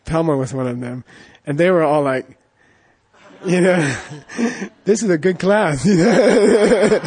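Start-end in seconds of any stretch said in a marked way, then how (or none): 3.5-7.1 said through laughter, higher; giggling retold praise; laughing. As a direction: laugh while quoting happy students. Students' delight retold, laughing.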